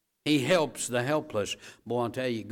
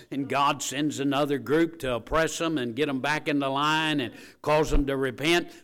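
ordinary talk, nothing marked

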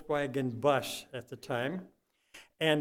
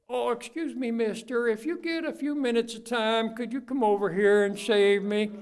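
higher, prim mock courtesy; mock polite request; 6 semitones higher, 3 dB louder than usual. A drowning swimmer asking the lifeguard, mock polite and higher. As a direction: politely ask for rescue as a joke. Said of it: "You're gonna say".

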